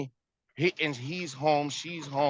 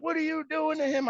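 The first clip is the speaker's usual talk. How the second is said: much higher, shrill-sounding mock accusation; hammed-up protective mom; mock mother; 12 semitones higher than usual. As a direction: imitate his mother rushing out to defend him. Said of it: "she's like".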